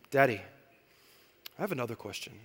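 put-on hushed child's question; daughter's voice. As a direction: ask like a curious small child. Daughter asks again.

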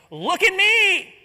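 high, loud, slow showing-off cry; pompous boast. Acted. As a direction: preen like a proud Pharisee. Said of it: "this pompous attitude of".

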